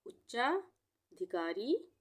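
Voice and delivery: उच्चाधिकारी stretched very slowly so the double च and every syllable is heard; teacher dictating उच्चाधिकारी slowly; slow broken reading. Shown as a demonstration. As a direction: sound it out for beginners. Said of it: "तोड़ के पढ़ना चाहें तो".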